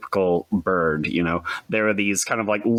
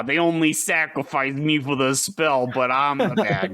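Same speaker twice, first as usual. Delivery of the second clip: higher-pitched whiny comic bad guy; goofy villain voice; 6 semitones higher than usual. Host riffing as the sacrificed villain, in a goofy high voice. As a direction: play the villain as a silly, aggrieved cartoon. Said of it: "if I had to voice that character".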